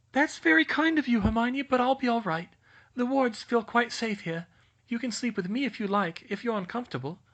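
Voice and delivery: put-on high, airy dreamy girl. Luna keeps talking.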